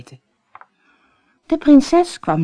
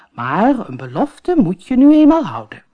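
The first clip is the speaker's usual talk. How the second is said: louder, stern fatherly voice; firm king; 5 semitones higher, 6 dB louder than usual. Acted. King insists the promise be kept, firm and loud. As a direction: settle it firmly.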